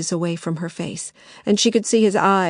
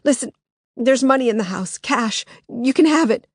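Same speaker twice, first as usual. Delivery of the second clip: pleading; 5 semitones higher than usual. Acted. Begging for her life.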